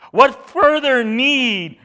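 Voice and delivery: high, loud, slow outburst; high priest tearing his clothes, loud and slow; dramatic outrage. Acted. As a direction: erupt in outrage. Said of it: "Caiaphas responds dramatically".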